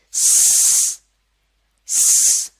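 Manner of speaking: a sharp voiceless hiss, स with no voice; loud unvoiced s hiss; hissed s sound. Shown as a demonstration. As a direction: push air through the teeth for स. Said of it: "क्या sound होती है?".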